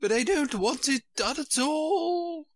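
high-pitched storybook moan; groaning Queen. A narrator's reading. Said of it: "groaned the poor Queen".

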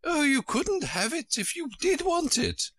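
very high-pitched storybook queen; high Queen correcting Alice; fussy Queen. A narrator's reading. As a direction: put on a high, querulous royal voice.

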